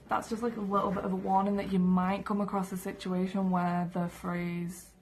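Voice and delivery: ordinary delivery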